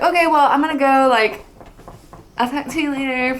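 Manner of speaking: awkward high goodbye. Retold or imitated. Retold awkward exit from him.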